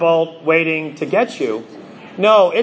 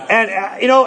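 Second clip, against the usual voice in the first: ordinary talk, nothing marked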